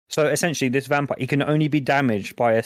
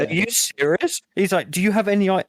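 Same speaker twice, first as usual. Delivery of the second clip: mock fed-up; 6 semitones higher than usual. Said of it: "starts going mental about it".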